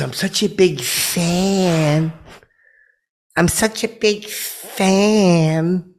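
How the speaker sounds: high, slow starstruck gush; gushing fan. Retold or imitated. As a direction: swoon like a smitten fan. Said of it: "And she goes".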